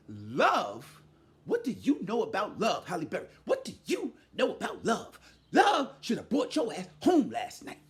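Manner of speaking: low, lightly acted film line; a hint of the Boomerang scene; Boomerang movie quote. Quoting the Boomerang scene to the host, low and mocking. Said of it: "that scene in Boomerang when it said".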